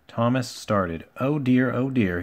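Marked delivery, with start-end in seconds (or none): none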